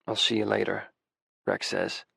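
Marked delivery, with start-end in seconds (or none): 0.1-0.9 understated deep man's goodbye; deeper voice. A narrator's reading. Boyfriend signing off on the phone, deeper voice. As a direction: let the voice settle a touch deeper and softer. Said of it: "his voice even deeper".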